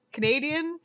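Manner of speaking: usual speaking voice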